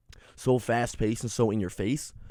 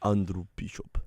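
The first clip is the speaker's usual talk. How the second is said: lower, mock Russian accent; 4 semitones lower, 4 dB quieter than usual. Shown as a demonstration. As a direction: say it low with a put-on Russian accent. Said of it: "which one sounds better?".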